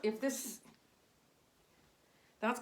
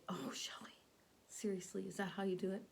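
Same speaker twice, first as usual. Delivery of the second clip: whispered; 2 semitones lower, 8 dB quieter than usual. Pretend. Imagined viewer questioning her method.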